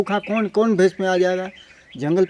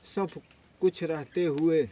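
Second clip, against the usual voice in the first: halting, soft, with long pauses; holding back tears; 6 dB quieter than usual. Real, not acted. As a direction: struggle to get each phrase out while tearful. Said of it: "महाराज जी का आंसू नहीं रुकने का नाम ले रहे हैं".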